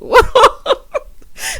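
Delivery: high, loud cackle; bright burst of high laughter; real laugh. Real, not acted. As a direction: crack up at your own joke. Laughs at own budget joke.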